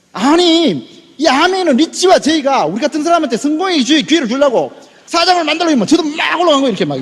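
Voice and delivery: high-pitched heated protest; flaring anger. Retold or imitated. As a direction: argue back as your temper rises. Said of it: "제가 화가 확 나는 거예요". (화가 확 나서 높게 따지는 말투)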